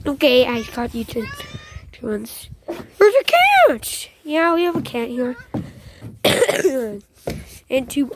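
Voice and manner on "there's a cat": high, slow excited cry of "cat"; sudden high delight at a cat; excited cat spotting. Real, not acted. Spots a cat in game.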